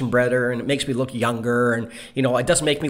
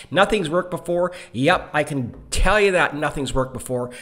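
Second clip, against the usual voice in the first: ordinary talk, nothing marked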